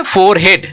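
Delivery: "फोरहेड" as fore + head, h sounded; wrong pronunciation. Shown as a demonstration. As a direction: keep "head" whole in "फोरहेड". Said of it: "अब ये फोरहेड नहीं बोला जाता". ("फोरहेड" में ह साफ़ बोलें, फ़ोर-हेड)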